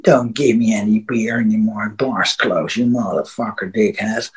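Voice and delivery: low, soft muttering; mock drunken mutter, pitched well down; drunk talking to himself. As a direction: imitate a drunk grumbling under his breath. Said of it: "and talked to himself".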